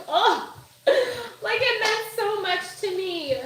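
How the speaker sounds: high, loud "oh" melting into a laugh; touched laugh. Retold or imitated. Moved by a kind message, a high loud laughing "oh". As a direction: gush with happy disbelief. Overwhelmed by sister's sweet reply.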